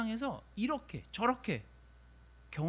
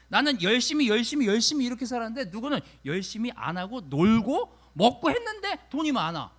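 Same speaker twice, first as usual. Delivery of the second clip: higher and louder, venting, 열심히 hammered again and again; frustrated preaching; 5 semitones higher, 8 dB louder than usual. Acted. Preacher voicing a hardworking believer's frustration, heated. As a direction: rant it as if fed up.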